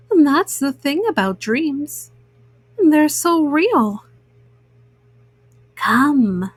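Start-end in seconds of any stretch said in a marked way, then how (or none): 5.7-6.5 lower, eerie monster voice; low, coaxing dream monster; green-eyed monster. A narrator's reading. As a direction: beckon her like a tempting little monster. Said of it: "said the green-eyed monster".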